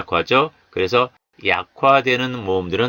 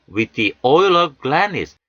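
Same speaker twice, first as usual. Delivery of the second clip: slow careful reading; 4 semitones higher, much slower than usual. Shown as a demonstration. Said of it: "Words and pronunciation".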